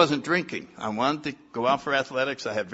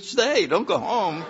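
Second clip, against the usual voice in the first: laughing plea; 8 semitones higher, 3 dB louder, slower than usual. Retold or imitated. Begging, slow, with laugh.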